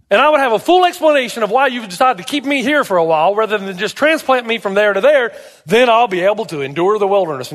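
high, insistent appeal; pleading Job. Acted. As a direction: plead loudly with God for an explanation. Acting Job pleading with God.

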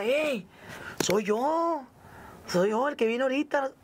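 mock call for recognition; retold calling out. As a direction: hail a crowd that should know you. Calling out to the crowd.

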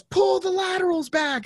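high shouted command; yelled order. Retold or imitated. Soldier yelling at the new driver, high shout. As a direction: bark it across the yard. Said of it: "somebody yells".

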